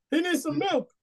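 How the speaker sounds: very high put-on voice; comic high-pitched wail; mock cry. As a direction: squeal it as a joking catchphrase. Quotes a meme line in jest.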